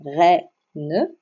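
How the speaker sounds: reine as two beats, "rè... ne"; split into syllables. Shown as a demonstration. Teacher showing pupils two syllables, reine split apart. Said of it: "bien composé de deux syllabes".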